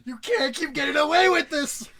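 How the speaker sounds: high, loud outraged yell; meme shout. Acted. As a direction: scream it like the meme.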